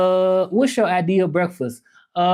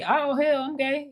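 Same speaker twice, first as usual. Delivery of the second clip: mock shocked gasp; 11 semitones higher, 3 dB quieter than usual.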